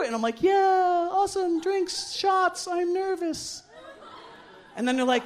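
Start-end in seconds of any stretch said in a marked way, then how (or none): 0.4-3.6 high-pitched, forced party enthusiasm; acted nervousness. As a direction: cheer along while secretly panicking. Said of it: "I'm getting really nervous".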